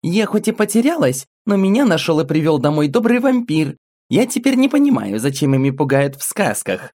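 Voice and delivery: put-on high, bright child voice; little girl voice. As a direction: speak as a small girl telling her story. Little girl character speaking.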